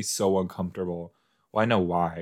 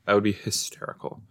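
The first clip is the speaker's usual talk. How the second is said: ordinary delivery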